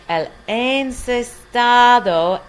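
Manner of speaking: El encestado drawn out, en-ces-TA-do; teacher modeling El encestado; slow model word. Shown as a demonstration. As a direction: say El encestado slowly for learners.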